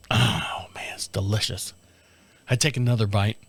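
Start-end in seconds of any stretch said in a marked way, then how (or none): 0.1-1.7 hushed, blissful whisper; savoring whisper. Retold or imitated. Husband tastes the Baconator, blissful whisper. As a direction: murmur it through the pleasure of the bite.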